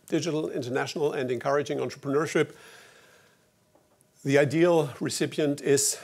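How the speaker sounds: ordinary talk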